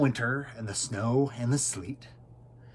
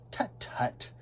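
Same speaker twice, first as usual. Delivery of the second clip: tut tut; 4 semitones higher, 10 dB quieter than usual. Acted. Maisie tutting at elephant.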